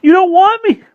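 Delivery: high, loud wailing sob; sobbing plea. Acted. Weeping boy begs the vampire to spare him, sobbing. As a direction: cry and beg at once. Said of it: "he sobbed".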